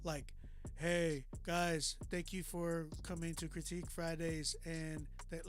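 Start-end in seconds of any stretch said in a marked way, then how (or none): 0.8-4.6 deliberately monotone greeting. Shown as a demonstration. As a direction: recite it with no change in pitch. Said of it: "that's very monotone".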